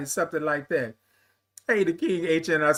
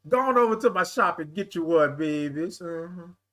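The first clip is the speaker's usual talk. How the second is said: normal talking voice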